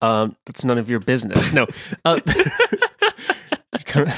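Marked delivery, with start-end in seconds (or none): 2.1-4.1 breaking into laughter mid-line; words swallowed by a laugh. Real, not acted.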